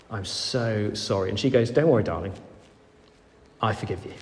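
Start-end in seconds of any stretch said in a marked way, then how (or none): 0.1-1.3 contrite apology. Retold or imitated. Vicar apologising to wife Hannah. 1.7-4.1 tender reassurance. Retold or imitated. Wife Hannah forgiving her husband.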